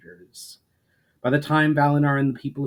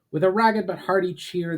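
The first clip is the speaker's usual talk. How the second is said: usual speaking voice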